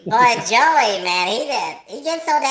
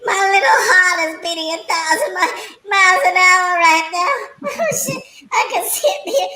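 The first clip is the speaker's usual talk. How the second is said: laughing breathlessly; 6 semitones higher than usual. Real, not acted. Still laughing, can't catch breath.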